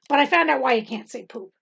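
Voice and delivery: normal talking voice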